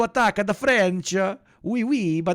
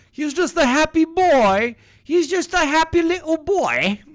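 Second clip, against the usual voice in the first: much higher and louder, doting and cutesy; high doting coo over a happy little boy; cooing baby talk; 9 semitones higher, 5 dB louder than usual. Acted. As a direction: coo at him like a proud parent.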